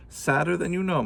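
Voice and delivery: put-on a touch of a sad old woman. Old woman replies to Kate.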